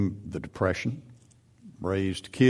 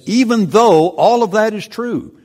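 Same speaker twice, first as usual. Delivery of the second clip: much higher and louder emphatic reply; God answering; 13 semitones higher, 11 dB louder than usual. Acted. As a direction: burst out with this high and loud. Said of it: "God, in effect, says".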